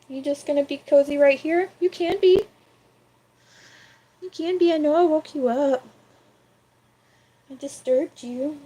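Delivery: high cooing voice. Real, not acted. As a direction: sweet-talk the sleepy cat. Cooing to the cat.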